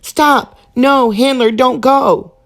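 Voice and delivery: high, loud panicked cry to stop; frantic shout. Acted. Dog yelling at the squirrel driving, frantic shout. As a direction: shout him to a halt before the cliff.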